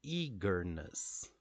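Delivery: "Eagerness" slowly, strong EA, two light syllables after; first-syllable stress. Shown as a demonstration. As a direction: model "Eagerness" for repeating, EA stressed. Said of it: "Can you repeat with me?".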